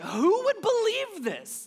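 high, loud, slow incredulous cry; who would believe this, appalled; indignant outrage. Shown as a demonstration. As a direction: demand it, outraged. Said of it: "you gotta say it like that".

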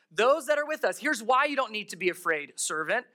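usual speaking voice

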